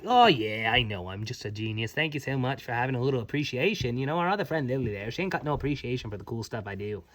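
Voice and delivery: low, fast, boastful; smug Tink. Acted. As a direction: brag lazily about your genius. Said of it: "She's like".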